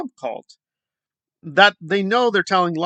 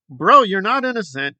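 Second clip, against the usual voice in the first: ordinary talk, nothing marked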